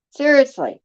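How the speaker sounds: ordinary delivery, nothing marked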